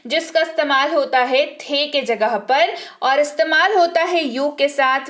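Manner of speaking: ordinary talk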